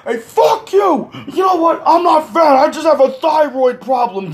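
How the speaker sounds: high-pitched indignant yelling; imitating an outraged man defending himself; shrieky, hammed-up self-defense; angry protest.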